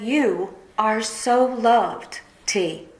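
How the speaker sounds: slow, tender, each word held; tender quote. Retold or imitated. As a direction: say it slowly like a gentle reassurance. Friend's text quoted again.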